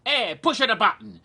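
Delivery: put-on thick Italian accent, high and loud. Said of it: "he had a thick Italian accent".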